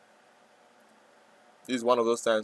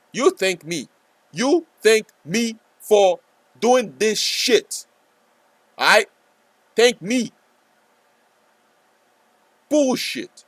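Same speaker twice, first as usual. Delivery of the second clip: higher, louder, slow indignant rant; hammed-up angry yell; mock outrage; 10 semitones higher, 6 dB louder, much slower than usual. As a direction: play at being furious with the viewers.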